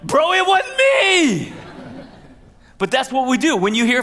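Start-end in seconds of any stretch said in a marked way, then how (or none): 0.0-1.5 high, loud, slow, laughing "not me"; a loud, slow, laughing protest to the golfers; laughing denial. Retold or imitated. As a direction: shout your innocence through laughter. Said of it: "standing over there like".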